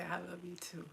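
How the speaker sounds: a faint, barely voiced I love you too; mother mouthing it back, almost silent; mouthed softly. Dying mother mouthing love back to her daughter, softly. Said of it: "And she would mouth it back".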